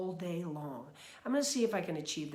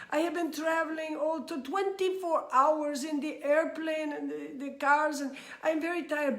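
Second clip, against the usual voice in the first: Dalai Lama impression; 6 semitones higher than usual. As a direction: impersonate a tired old monk with a high, sing-song voice. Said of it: "he has a very high-pitched".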